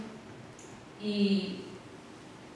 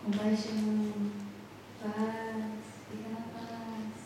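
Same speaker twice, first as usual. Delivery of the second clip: calm reminder, fading much softer; a gentle inner voice that settles him down; 9 dB quieter, slower than usual. Retold or imitated. Voicing the Holy Spirit's reminder. (voz interior calma, cada vez mais baixa)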